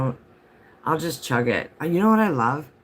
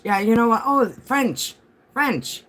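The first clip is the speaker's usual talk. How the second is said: delighted, pitched way up; excited high voice; 9 semitones higher than usual. Real, not acted. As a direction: gush with sudden delight. Excited about French heritage.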